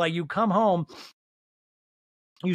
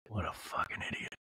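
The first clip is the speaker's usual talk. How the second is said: low, softer whispered macho grumble; hushed mock macho; 10 semitones lower, 13 dB quieter than usual. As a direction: mutter it under your breath like a tough guy. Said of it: "Like, very manly".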